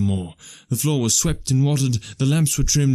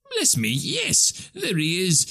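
high, drawn-out exclamation; delighted recognition; 8 semitones higher, slower than usual. Acted. Scrooge spots his old friend Dick, delighted high exclamation.

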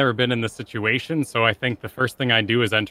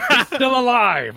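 much higher, louder, slow protesting yell; yelling at the crowd; 12 semitones higher, 4 dB louder than usual. Acted. Fighter yells back at the booing crowd, slow loud protest. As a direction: shout your defence over the booing. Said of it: "I argue with the crowd".

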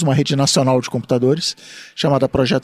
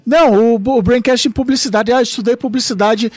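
high, loud mock fan gushing; 8 semitones higher, 4 dB louder than usual. Mocking fan praise, high.

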